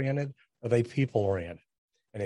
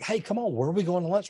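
impatient push; 7 semitones higher, faster than usual. Retold or imitated. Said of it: "when I'm like".

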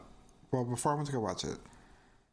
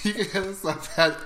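laughing; 4 dB louder than usual. Real, not acted. Laughing while giving handle.

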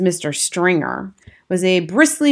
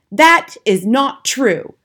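indignant cry; 5 semitones higher, 4 dB louder, slower than usual. A narrator's reading. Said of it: "cried Mister Stringer".

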